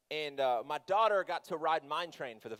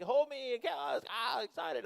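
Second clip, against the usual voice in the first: high-pitched imitation of an excited daughter; mock a thrilled kid, pitched up; 8 semitones higher than usual. Dad acting out daughter on the ride, excited. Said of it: "and she's excited".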